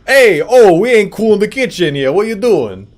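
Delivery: put-on loud, high-pitched Jersey Italian accent; hammed-up Jersey Italian holler. Streamer reading a line out, loud Jersey Italian accent. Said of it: "a Jersey Italian accent".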